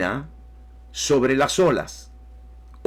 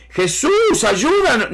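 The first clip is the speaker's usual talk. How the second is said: much higher and louder, a pleading cry to be saved; mock cry for help; 5 dB louder, slower than usual. Preacher voicing disciples begging Jesus for help, high desperate cry. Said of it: "ellos no estaban diciendo".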